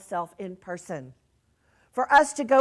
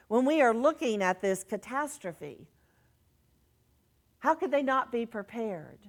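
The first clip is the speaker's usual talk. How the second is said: normal talking voice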